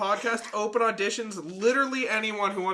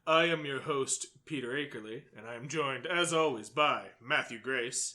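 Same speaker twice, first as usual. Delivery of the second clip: sultry spooky voice; 5 semitones lower, 4 dB quieter than usual. Acted. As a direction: purr the intro low and spooky. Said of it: "Or sultry voice".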